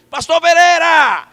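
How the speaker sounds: high, very loud, drawn-out holler; full shout. Retold or imitated. Visitor shouting a near-deaf sleeper awake, full shout. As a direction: bellow the name slowly to rouse the deaf. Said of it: "Aí eu encostei e gritei".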